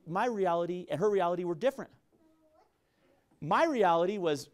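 ordinary talk, nothing marked

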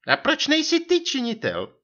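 high-pitched pouncing wife; vyčítavá manželka. A narrator's reading. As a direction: cut in reproachfully as the wife. Wife interrupts with reproach. (vyčítavá otázka vysokým hlasem)

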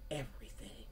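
breathy whispered echo of the word. Real, not acted. Reader echoing her message to viewers, whispered. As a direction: whisper the word to let it sink in. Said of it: "Everything happens in divine timing".

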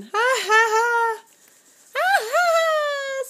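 high, slow put-on baby laugh; high cooing ha ha ha; playful mock laugh. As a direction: laugh along with the giggling baby.

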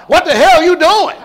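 high, loud acted indignation; indignant outburst. Acting out pastor's outburst.

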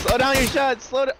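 high, loud urgent callout; excited shout. Real, not acted. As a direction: yell at a teammate mid-fight. Excited callout mid-fight.

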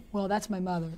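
normal talking voice

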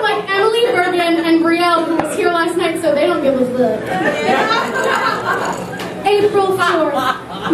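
ordinary talk, nothing marked

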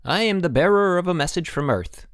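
put-on high-pitched boy's proclamation; messenger boy in mock-formal greeting. A narrator's reading. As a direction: announce it high, like a little herald.

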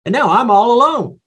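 high, hammed-up complaint; exaggerated poor-me protest; mock self-pity. Voices Elijah's self-pity.

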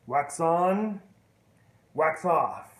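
wax on, wax off loud like a command; called drill. Retold or imitated. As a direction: bark the drill at him.

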